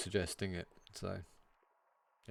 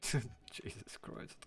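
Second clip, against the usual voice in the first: laughing; 8 dB quieter, much slower than usual. Real, not acted. Laughing fit after flubbed greeting.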